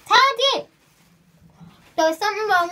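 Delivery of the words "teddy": high, loud call. Real, not acted. Vlogger breaks off to call Teddy, calling out. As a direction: call the name out sharply higher and louder. Calls out to Teddy mid-recording.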